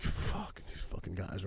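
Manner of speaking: hushed, breathless curse under the breath; muttered curse. Retold or imitated. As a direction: swear so the cops can't hear. Swearing privately at the cops.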